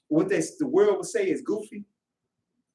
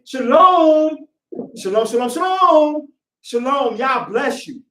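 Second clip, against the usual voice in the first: loud, high, joyful shalom calls; arriving at church and greeting everyone loudly; exuberant greeting; 7 semitones higher, 9 dB louder, slower than usual. Retold or imitated. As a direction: burst in greeting everyone gladly.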